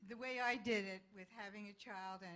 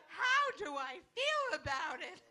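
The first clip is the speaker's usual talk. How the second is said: much higher, loud incredulous scream with laughter; wife screaming at her husband over the phone; 9 semitones higher, 13 dB louder than usual. Retold or imitated. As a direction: shriek it back in disbelief, laughing. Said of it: "I scream".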